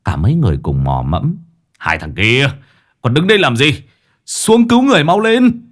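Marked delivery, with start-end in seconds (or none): 1.8-5.6 angry command, rising to a shout; urgent shouted order. Acted. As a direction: build to a high-pitched yell. Master orders men into the pond.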